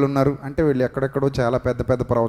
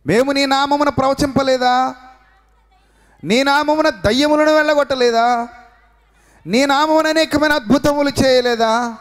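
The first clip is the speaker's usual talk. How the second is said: high, raised voice of people pleading their case; pleading claimants; 9 semitones higher than usual. Retold or imitated. The many pleading with God on that day, high and insistent. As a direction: protest to God that you did great works.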